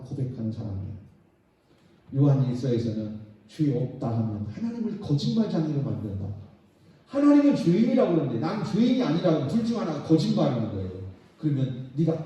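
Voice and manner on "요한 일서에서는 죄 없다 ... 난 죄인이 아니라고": normal talking voice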